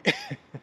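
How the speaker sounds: a short squeaky laugh, very high; high squeal laugh. Real, not acted. Laughing at pranked politicians.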